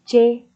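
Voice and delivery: C said very loud and sharp; stressed first sound. Shown as a demonstration. Teacher showing students the stressed ch sound, very loud. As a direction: hit the C hard and loud. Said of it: "cahnya ya".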